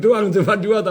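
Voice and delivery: words breaking into a real laugh. Real, not acted. Host amused at bosses who only fear or pity, laughing. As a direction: chuckle through it, amused at the idea.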